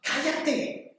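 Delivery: high, hushed mock scolding; crowd shushing a beggar. Everyone telling the blind man to hush, high muted scolding. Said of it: "todos le decían al ciego".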